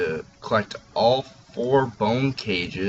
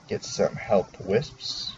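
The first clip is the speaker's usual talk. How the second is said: ordinary talk, nothing marked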